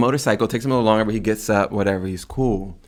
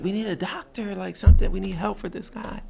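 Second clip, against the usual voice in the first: urgent plea; 10 semitones higher than usual. Retold or imitated. Pleading with passing tourists.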